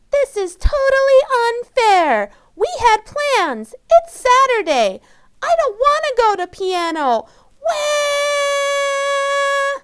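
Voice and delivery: put-on little amygdala girl wailing over piano; tantrum wail. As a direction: bawl it out like an upset small child. Amygdala wails about plans.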